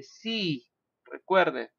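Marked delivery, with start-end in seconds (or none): 0.0-0.6 "sea" with a drawn-out ee; long I in sea. Shown as a demonstration. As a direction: stretch the vowel in "sea" as one long ee. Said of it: "mar es una I larga".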